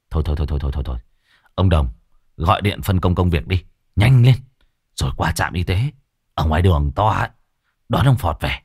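low voice cutting off a squabble; impatient master. Acted. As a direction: rattle off the stops, then give orders.

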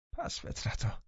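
hissed under the breath; whispered insult. A narrator's reading. Linton cursing under his breath, whispered. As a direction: spit a whispered curse.

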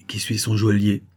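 ordinary delivery, nothing marked

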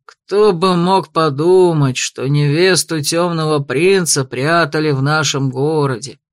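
low, slow, heavy man's voice; gruff proclaiming man. A narrator's reading. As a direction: announce it like a burly thug. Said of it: "возвестил один из новоприбывших, потрясая увесистым кулаком".

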